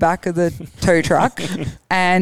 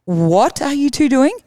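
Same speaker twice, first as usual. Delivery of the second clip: slow, higher, disbelieving father on the phone; incredulous dad; 7 semitones higher, slower than usual. Retold or imitated. Father on the phone hearing of his kids on a tow truck, slow and disbelieving. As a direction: drag it out like a baffled dad. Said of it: "and Dad's like".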